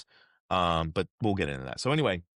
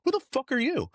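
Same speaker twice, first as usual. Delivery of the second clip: mock incredulous director; faster than usual. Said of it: "And he goes".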